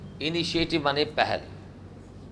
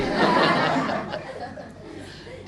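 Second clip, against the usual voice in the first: laugh; 3 semitones higher than usual. Real, not acted. Teacher laughs at the girl's delight.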